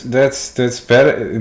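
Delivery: normal talking voice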